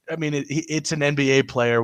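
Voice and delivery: normal talking voice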